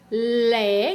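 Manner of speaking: Lé said on its own, stressed; first syllable alone. Shown as a demonstration. Teacher showing the first syllable to children. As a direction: isolate Lé as one beat. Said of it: "o primeiro pedacinho é lé".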